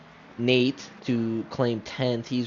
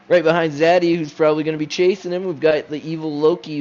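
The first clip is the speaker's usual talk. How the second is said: hyped-up introduction; 5 semitones higher, 8 dB louder, faster than usual. Real, not acted. Hyping up the evil Loki.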